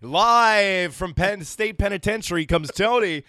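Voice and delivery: slow, loud show-intro announcer. Pretend. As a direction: announce it like a broadcast.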